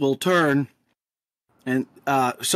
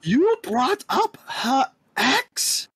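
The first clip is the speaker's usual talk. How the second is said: shocked; 9 semitones higher, much slower than usual. Acted. Shocked at Ramon's blunder.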